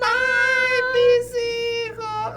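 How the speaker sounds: high, slow sung wail; keen it out like a mourning ghost; weeping ghost woman. Acted. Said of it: "La Llorona".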